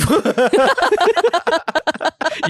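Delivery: high, helpless cackle; loud laughing fit. Real, not acted. Cracking up at the joke.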